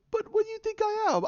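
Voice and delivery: very high, fussy droid protest; C-3PO. Retold or imitated. Droid objects to downloading coordinates, high imitated protest. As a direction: squeak it out as an affronted robot.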